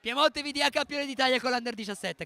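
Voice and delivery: high, loud victory call; triumphant announcement. Real, not acted. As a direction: proclaim the champions at full voice. Annuncio trionfale della vittoria del titolo. (annuncio trionfale a gran voce)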